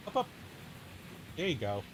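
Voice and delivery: usual speaking voice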